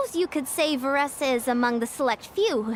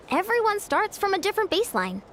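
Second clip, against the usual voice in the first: ordinary delivery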